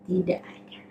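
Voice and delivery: soft unvoiced whisper; whispered answer. Real, not acted. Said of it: "answers own question".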